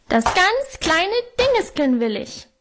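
put-on high wailing little boy; toddler tantrum. A narrator's reading. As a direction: whine it like a stamping toddler. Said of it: "heulte er".

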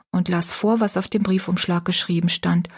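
ordinary delivery

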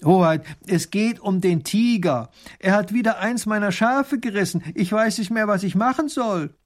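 high, pleading call. A narrator's reading. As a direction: call up to a ruler in distress. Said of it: "Der ruft hinauf".

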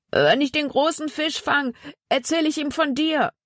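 put-on high boyish voice, looking back; a promise shouted back over the shoulder; Zack answering. A narrator's reading. Zack replies to Jonathan while fleeing, high boyish voice.

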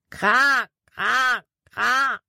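hoher, lauter Krähenruf; Krähenruf nachgeahmt. Acted. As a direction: wie eine Krähe krächzen. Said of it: "Erneut schrie Jordan". (Jordan gibt Caleb ein Warnsignal, krächzend wie eine Krähe)

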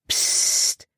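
sharp unvoiced shush; warning hush. A narrator's reading. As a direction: hush her at once. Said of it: "warnte Caleb". (warnendes Zischen)

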